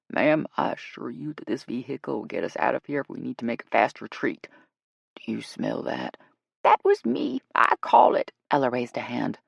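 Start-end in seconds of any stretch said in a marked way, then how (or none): 0.1-6.2 low guide voice; self-assured guide. Acted. Guide promising Shay a fast retreat, low voice. 6.6-8.3 high, loud confession; sheepish owning up. Acted. Ella owning up to the smell, high and loud.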